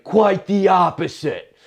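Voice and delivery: high, loud, slow mock retort; brash girl retort. Drunk girl answers the comic, high and loud. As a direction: drawl it out like a shameless drunk.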